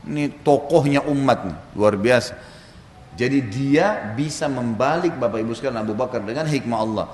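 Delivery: usual speaking voice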